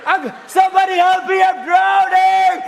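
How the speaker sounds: loud, slow hammed-up cries for help; mock drowning cry. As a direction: ham up a drowning man.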